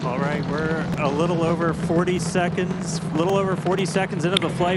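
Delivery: high, fast launch commentary; excited. Real, not acted. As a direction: rush it out, thrilled. Commentator reacting to liftoff.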